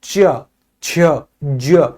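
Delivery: च, छ, ज each released slowly and held a little long; slow teacher demo, च छ ज lengthened; slightly drawn-out affricates. Shown as a demonstration. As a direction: let each of च, छ, ज stretch a touch. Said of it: "हल्का सा लंबा उच्चारण होता है".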